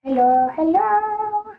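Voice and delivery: high, slow, sweet cooing hellos; voicing the bird. Streamer voicing her pet bird Bray May, cooing. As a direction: coo like a little bird. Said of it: "He's like".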